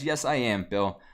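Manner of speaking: ordinary talk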